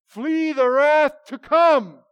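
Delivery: huge, high, drawn-out open-air preaching shout; Whitefield's booming voice. Retold or imitated. Imitating a preacher crying to thirty thousand people outdoors, booming voice. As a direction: boom it slowly across a vast field. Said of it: "saying in that booming voice".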